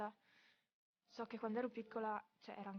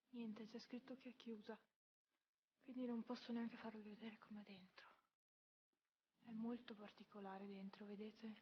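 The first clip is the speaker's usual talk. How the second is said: whispering; 7 dB quieter than usual. Real, not acted. Church closed, hushed near it.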